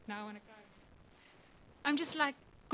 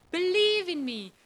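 higher, louder plea of believe in me; pleading God; 5 semitones higher, 5 dB louder than usual. Acted. God urging the church, rising and insistent. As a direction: press the word Believe harder. Preacher repeating God's plea.

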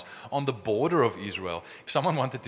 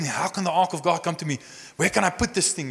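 upset David; 6 semitones higher than usual. Retold or imitated. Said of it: "David's angry and he's upset and he's afraid".

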